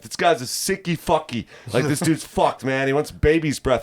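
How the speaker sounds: high, worked-up protest; retold outrage. Telling Kenny about the weird customer, high and outraged.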